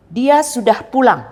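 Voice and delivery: DIA and PULANG hit loud and high, falling at the end; loud, high emphatic assertion, stressed on DIA and PULANG; firm exclamation. Shown as a demonstration. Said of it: "Jadi intonasinya agak keras".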